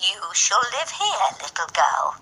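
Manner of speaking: soft coaxing woman. Acted. Old woman softly coaxing Gerda to stay, lightly voiced. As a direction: coax the girl gently, like a lullaby. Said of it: "softly combed Gerda's long hair and said".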